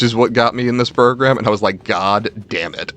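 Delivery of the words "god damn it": ordinary talk, nothing marked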